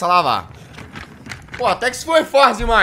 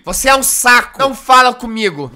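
louder mock snap; mock angry mother; 5 semitones higher, 6 dB louder than usual. Gamer voicing the mother rejecting her son, mild mock yell. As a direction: brush the child off as the angry mom.

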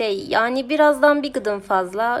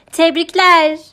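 loud, high announcement; congratulatory shout; 7 semitones higher, 12 dB louder, slower than usual. A narrator's reading. Woman congratulates the winner, shouting. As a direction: call it out to a crowd. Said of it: "diye bağırdı".

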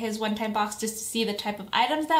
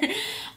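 excited giggle; 10 semitones higher, 6 dB quieter than usual. Real, not acted.

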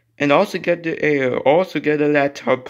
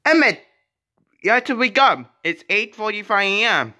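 high-pitched pretend wake-up call; 6 semitones higher than usual. Waking Emmett for school in play, urgent calling. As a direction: call out to rouse a sleeper.

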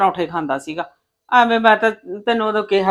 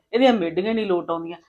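usual speaking voice

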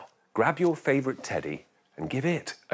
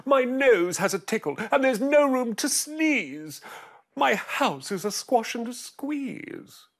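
put-on old lady crying out; 12 semitones higher, 3 dB louder than usual. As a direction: wail it like a frazzled old lady. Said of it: "The little old lady cried".

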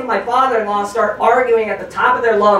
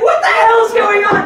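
shocked bystander; 12 semitones higher, 3 dB louder than usual. Pretend. Comedian voicing stunned bank customers. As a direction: gasp it like a panicked hostage. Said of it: "Everybody's gonna be like".

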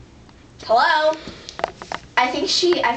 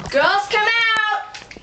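high, drawn-out summons; calling out; 8 semitones higher, slower than usual. Acted. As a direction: call them out from backstage in a high singsong. Host calls contestants from backstage.

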